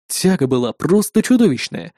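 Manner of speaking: ordinary delivery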